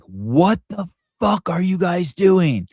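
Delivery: high, slow, exasperated mock yell at the kids; reactive yell. Father catching his sons drawing on the house, a mock yell. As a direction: blurt it out as if losing control. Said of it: "I think that's bad yelling".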